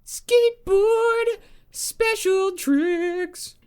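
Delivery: high drawn-out announcement. Pretend. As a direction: stretch it like a cartoon voice. Joking character bit.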